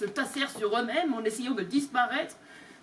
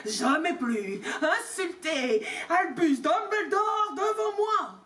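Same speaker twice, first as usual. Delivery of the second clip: furious shout; 5 semitones higher, slower than usual. Acted. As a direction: bellow it in rage. Hagrid furious, umbrella raised. (Hagrid hurle, furieux)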